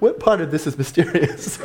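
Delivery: amused rhetorical question through a laugh; giggling disbelief. Real, not acted.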